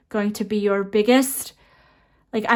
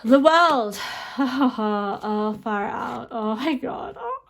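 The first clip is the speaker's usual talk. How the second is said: laughing; much slower than usual. Real, not acted. Laughing over the World card.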